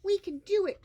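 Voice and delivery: high, loud cheer. Acted. Another Wiggleskin cheers, excited. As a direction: cheer the others on. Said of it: "cheered another".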